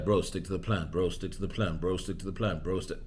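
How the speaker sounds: low, soft, repetitive coach voice; imitated coach. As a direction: drone it quietly like the coach's mantra. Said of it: "He's like".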